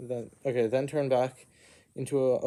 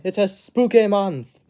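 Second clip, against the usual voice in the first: much higher, louder, slowed-down shout; excited cheer; 8 semitones higher, 9 dB louder than usual. Acted. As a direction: belt it out like a party call. Cheering to his new pet.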